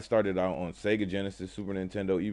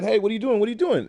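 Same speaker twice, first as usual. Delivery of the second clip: high, loud panicked squeal; a frightened frog yelping at the eagle; alarmed frog; 12 semitones higher, 6 dB louder, much faster than usual. Acted. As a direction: cry out in alarm mid-air.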